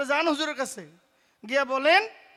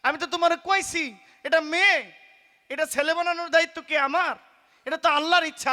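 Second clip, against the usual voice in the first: higher, louder stern rebuke of the saint; raised, scolding holy man's voice; 6 semitones higher, 5 dB louder than usual. Acted. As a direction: snap at the pleading mother. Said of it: "হুজুরে ধমক দিয়া বলে".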